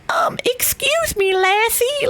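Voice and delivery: very high, whispery, slow frail voice; a timid old man asking politely; sweet little old man. Shown as a demonstration. Asking a stranger for help, frail sweet old man. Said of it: "sweet little old man".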